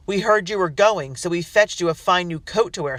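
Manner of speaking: loud, mocking shout; jeering shout. A narrator's reading. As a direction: taunt with false cheer. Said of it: "someone else shouted".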